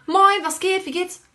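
high, bright greeting voice; breezy, upbeat hello; cheery greeting. Retold or imitated. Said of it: "und sag so". (fröhlich hohe Begrüßung)

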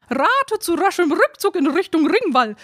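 high, cheeky bird voice calling out; a cheeky raven caws its advice. A narrator's reading. Cheeky raven calling advice to the others. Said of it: "rief einer der rotzfrechen Raben".

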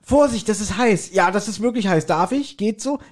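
high, mock-careful chatter; hammed-up fussy host. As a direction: fuss over the hot mugs. Acting out handing round hot punch.

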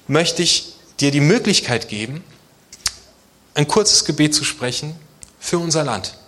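ordinary talk, nothing marked

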